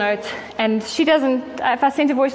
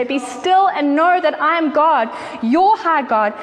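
higher, slow and gentle invitation; gentle voice of God; 5 semitones higher, slower than usual. Acted. Preacher voicing God calling weary listeners close, slow and higher. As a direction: draw them in, slow and high. Quoting God's command to stillness.

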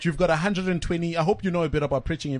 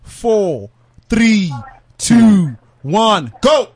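loud countdown, each number called out; 3 semitones higher, 11 dB louder, much slower than usual. Real, not acted. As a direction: call the count out loud to start the clock. Host counts down the pitch.

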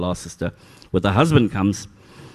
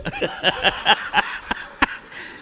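long, high, wheezy laugh; real helpless giggling, mostly breath; breathy laughter; 10 semitones higher than usual. Real, not acted. Man recalling his forgotten pin, laughing breathlessly.

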